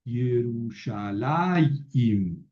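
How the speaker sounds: Yerushalayim drawn out very slowly, syllable by syllable; careful pronunciation. Shown as a demonstration. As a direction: spell out Yerushalayim slowly, ye-ru-sha-la-yim. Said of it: "pero se pronuncia antes". (pronunciación lenta: Ye-ru-sha-la-yim)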